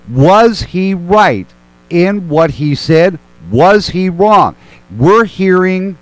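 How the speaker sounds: slow, spaced-out questioning with long pauses; slow deliberate question. Real, not acted. Host slowly putting the question to his guests, deliberate and spaced. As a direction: lay out each word slowly, making them listen.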